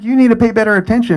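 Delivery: fast, high-pitched reprimand; retold brisk scolding; scolding officer. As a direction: imitate an officer telling someone off.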